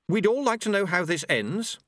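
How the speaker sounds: high, teacherly sarcastic question; classroom sarcasm. Acted. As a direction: challenge them like a sarcastic schoolteacher. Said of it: "reverting to classroom sarcasm".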